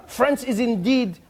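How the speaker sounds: put-on grand opening in a French accent. Ambassador reading out his letter, stately French accent. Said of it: "read it how I hope he wrote it".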